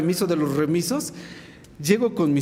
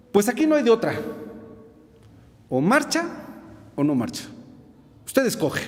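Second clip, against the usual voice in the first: imposing officer, higher on the key words; 4 semitones higher than usual. Retold or imitated. Military officer confronting a trembling young draftee, imposing. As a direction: lay down the ultimatum imposingly. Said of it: "en un tono así imponente".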